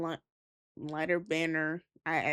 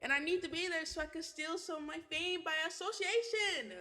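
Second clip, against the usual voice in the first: higher, softer, laid-back friend voice; Austin's voice; 8 semitones higher, 7 dB quieter than usual. Acted. Austin talking to his friend about finding aliens tomorrow, higher and softer. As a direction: tease your friend gently from the curb.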